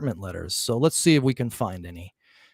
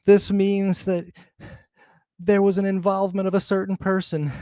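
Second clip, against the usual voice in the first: high, slow, drawn-out mock pundit voice, much higher and louder; mocking Maury voice; 7 semitones higher, 4 dB louder than usual. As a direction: ham up a pompous expert's reveal. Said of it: "Maury was like".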